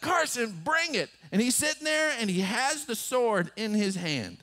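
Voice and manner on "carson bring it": high, strained come-at-me call; bracing dare again. Retold or imitated. Father bracing for son's swing. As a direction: dare him, half laughing. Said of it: "And so I just like".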